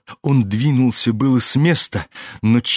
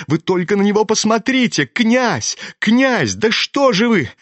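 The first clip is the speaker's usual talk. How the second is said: high, alarmed appeal; insistent, frightened calling; urgent cry; 9 semitones higher than usual. A narrator's reading. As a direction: beg the prince to act, pitch way up. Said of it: "крикнул Коля".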